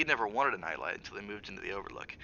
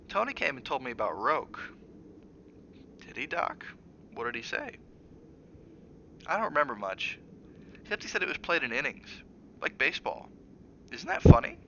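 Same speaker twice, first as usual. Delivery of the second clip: put-on sleepy child who brightens at the end; young boy, ending gleeful; 6 semitones higher than usual. Boy's voice, ends gleeful.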